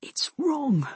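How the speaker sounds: breathy whisper in the ear; hushed, voiceless regret. A narrator's reading. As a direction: whisper it close and pained. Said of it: "he whispered in my ear".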